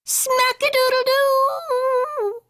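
very high, slow stretched cry; shouted. A narrator's reading. Kitty boy crashing into mama, shouting. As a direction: yell it as he crashes in. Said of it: "shouted the kitty boy".

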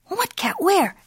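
high-pitched kitten question; puzzled kitten. Acted. Skipito to Don Diego, puzzled. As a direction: ask it high, bewildered.

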